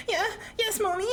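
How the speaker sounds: high, pleading yes mommy; desperate. Retold or imitated. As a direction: beg it desperately. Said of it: "I threw some real desperation into that".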